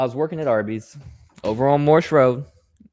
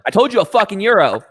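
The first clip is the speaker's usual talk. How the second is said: higher, louder irritated snap; mock angry customer; 8 semitones higher, 4 dB louder than usual. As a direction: scold the cashier. Customer yelling at staff.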